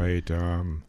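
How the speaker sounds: soft, sultry hey; pillow-talk greeting to a crush; intimate voice. Pretend. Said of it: "the voice that's reserved when I'm talking to Tom Brady".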